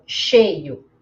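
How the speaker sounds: "cheio" stressed as SHAY-oo; E in diphthong. Shown as a demonstration. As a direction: say "cheio" with a clear E before the i. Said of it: "Som de E".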